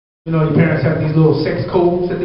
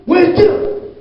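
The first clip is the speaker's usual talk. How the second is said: high mock dad; 14 semitones higher than usual. Dad asks after maid.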